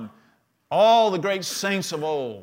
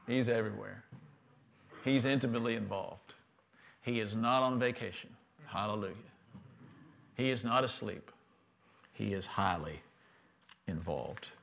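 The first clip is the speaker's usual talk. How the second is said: lower, much softer, almost confiding; hushed intimate preaching; 7 semitones lower, 12 dB quieter than usual. Real, not acted. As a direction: draw them close with a near-whisper. Soft intimate sermon passage.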